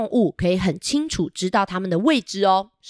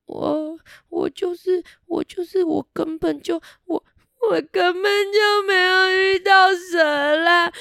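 higher, slow, sobbing plea; crying; 9 semitones higher, slower than usual. Acted. Buster begging Rex to stop pressing him, sobbing. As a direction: wail it out like a cornered little kid. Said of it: "他大哭了起来".

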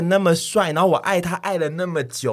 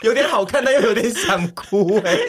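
laughing; 6 semitones higher than usual. Real, not acted. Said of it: "我想起来有点想哭欸".

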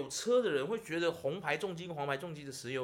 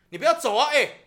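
loud, higher yell; retold yell; 9 semitones higher, 15 dB louder than usual. Yelling at the drunk driver not to leave, loud yell. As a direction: shout after him so he can't leave.

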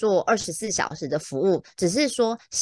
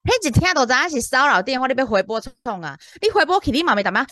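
louder retold scolding; exasperated roommate; 4 semitones higher, 4 dB louder than usual. Woken roommate scolding the agent about calling back, exasperated. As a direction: tell off a naive friend, voice jumping up. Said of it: "我室友他跟我说".

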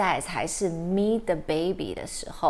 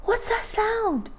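frightened; 11 semitones higher than usual. Acted. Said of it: "结果Biscuit吓坏了".

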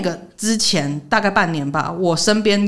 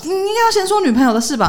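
exasperated; 14 semitones higher, 4 dB louder than usual. Real, not acted. Scolding him for leaving it out.

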